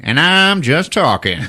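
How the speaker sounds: high, loud salesman banter; smooth-talking salesman. Retold or imitated. Salesman answering a man who is just looking, smooth-talking comeback. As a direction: toss it back like a pushy salesman. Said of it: "smooth-talking salesman".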